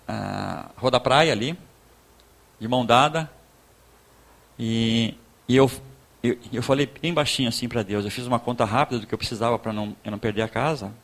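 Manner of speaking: ordinary delivery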